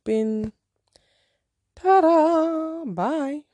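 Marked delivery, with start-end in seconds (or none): 1.8-3.4 high, loud sing-out sliding into bye; breezy dismissal. Acted. Waving off the match she won't waste time on, breezily dismissive. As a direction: sing out a mock farewell.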